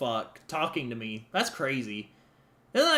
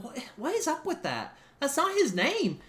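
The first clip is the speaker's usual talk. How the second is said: bystanders puzzled; 11 semitones higher than usual. Retold or imitated. Bystanders puzzled at the kid, higher voice. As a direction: sound confused by it. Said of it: "everybody around me will be like".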